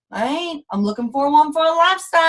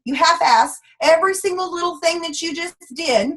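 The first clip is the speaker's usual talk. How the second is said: usual speaking voice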